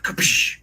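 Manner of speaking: a breathy unvoiced crash sound effect; whispered smash noise. Telling how he smashed a windshield, a breathy crash noise. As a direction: mimic the glass breaking with a quick hiss.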